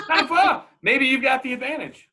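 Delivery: high, loud, laughing; laughing reassurance. Real, not acted. Friend laughs while telling her it is no flaw. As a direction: laugh it off warmly.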